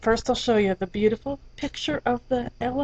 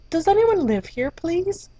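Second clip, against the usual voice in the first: higher, gentle butterfly pleading; polite butterfly; 7 semitones higher than usual. Acted. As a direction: ask sweetly from outside the door. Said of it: "and said".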